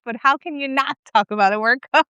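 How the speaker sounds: normal talking voice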